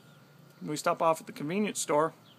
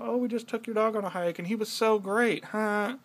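higher, sing-song mocking tone; mock sweet voice; 6 semitones higher than usual. Man mocking wife's cheerful text to her parents, sarcastic sweet voice. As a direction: sarcastically mimic a cheery brag. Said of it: "mocking wife's text to parents".

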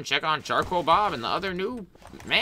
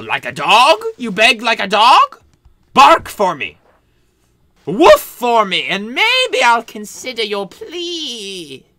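mocking taunt; 10 semitones higher, 12 dB louder than usual. Doctor taunts the begging assistant, loud and sneering. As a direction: taunt her cruelly.

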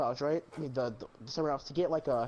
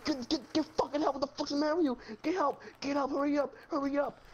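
mock yelling; 7 semitones higher than usual. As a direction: imitate frantic yelling in a raised pitch. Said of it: "he kept yelling at Luke".

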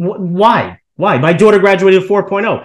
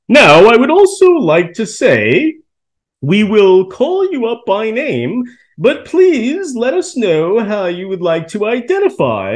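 slow, higher, monotone mock speech voice; robotic college president; 5 semitones higher than usual. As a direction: drawl it out like a robot announcer. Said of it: "This is how he talks".

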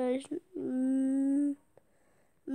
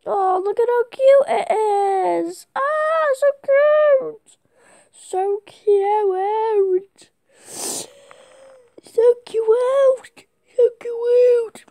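high squeal of delight; 10 semitones higher, 9 dB louder, much slower than usual. Real, not acted. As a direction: squeal over a cute puppy. Squealing over cute puppy.

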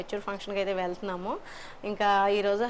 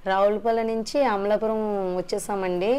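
normal talking voice

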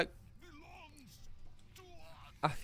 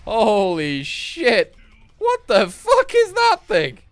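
much higher, louder, slowed amazed yell; stunned shout; 12 semitones higher, 13 dB louder, slower than usual. Real, not acted. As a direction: blurt it out in awe. Amazed at huge effect.

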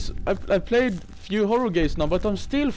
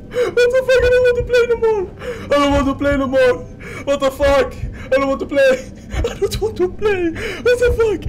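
high, half-sobbing laughter; laugh-crying; 10 semitones higher, 3 dB louder than usual. Real, not acted. Overwhelmed streamer refusing to play, laugh-crying. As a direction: wail between laughs. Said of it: "I'm crying here".

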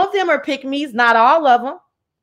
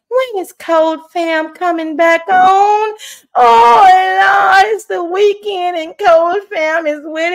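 mock-wailing Southern accent, rising higher and louder; 4 semitones higher, 3 dB louder than usual. Mock fan pining for an absent streamer, wailing Southern voice. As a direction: pine loudly like a melodramatic Southern damsel. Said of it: "get out my real Southern voice".

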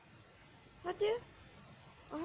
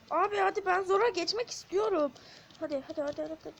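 ordinary talk, nothing marked

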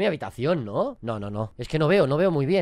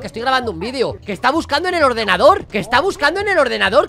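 higher, louder alarmed pleading; real alarm, higher and louder; frantic protest; 5 semitones higher, 6 dB louder than usual. Real, not acted. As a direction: keep protesting in a panic.

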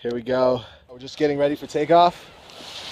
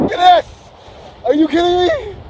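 higher and louder, breathless delight; real thrill; 11 semitones higher, 3 dB louder than usual. Real, not acted. Paraglider showing viewers the mountains, giddy and loud. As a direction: gush like you can't believe the view.

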